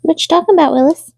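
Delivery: high playful "What you talking about, Willis"; catchphrase quote. Retold or imitated. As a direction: toss off the classic sitcom line. Quoting famous TV catchphrase.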